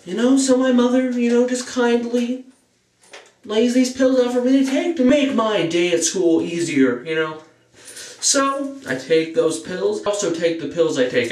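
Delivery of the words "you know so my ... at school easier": sing-song mother tale. Acted. Mom laying pills out, sing-song.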